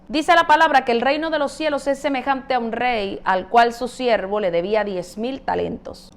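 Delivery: normal talking voice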